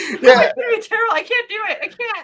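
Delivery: high laughing protest. Real, not acted. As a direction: protest through giggles. Refusing to do turkey impression.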